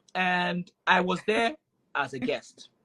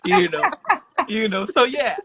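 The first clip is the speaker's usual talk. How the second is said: laughing; 10 semitones higher, 7 dB louder than usual. Real, not acted. Laughing at Khadija's smile.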